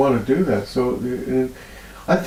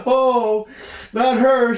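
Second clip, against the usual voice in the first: higher, emotional retold cry; overcome; 11 semitones higher, much slower than usual. Healer retelling the room in tears, high and emotional. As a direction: say it high, moved almost to tears. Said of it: "I'm going".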